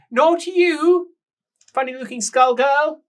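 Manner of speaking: very high, loud refusal; loud high protest. Acted. Rouged woman refusing to be taken upstairs, high loud protest. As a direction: protest like a spoilt grande dame.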